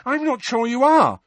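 high, loud doubt; agitated motherly challenge; heated retort. A narrator's reading.

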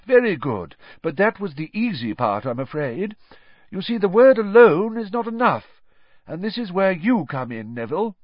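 encouraging teacher, pitched well above narration; Professor Lupin teaching. Acted. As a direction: explain to the class with emphatic key words.

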